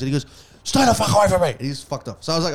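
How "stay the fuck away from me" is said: louder, higher retold outburst; mock angry snap. Retelling the guy's outburst at the party, louder and higher. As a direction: imitate the guy snapping at him.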